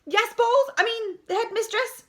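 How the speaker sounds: high, loud flustered answer; calling out. A narrator's reading. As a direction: call back, hastily correcting yourself. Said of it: "he called out from the playground".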